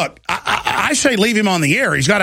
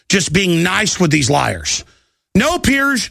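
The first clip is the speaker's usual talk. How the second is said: ordinary delivery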